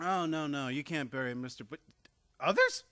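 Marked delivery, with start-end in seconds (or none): 2.4-2.8 shocked. Acted. Shocked realization of bodies.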